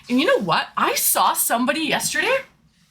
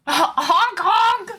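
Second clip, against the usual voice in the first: playful honk; 5 semitones higher, slower than usual. Pretend. Playful honking noises.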